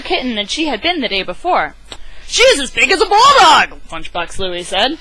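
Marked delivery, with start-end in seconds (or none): 2.3-3.8 high, loud astonished cry; loud amazed outburst; loud exclamation. Acted. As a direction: announce it like a shocked father. Louie's exclaimed line in story.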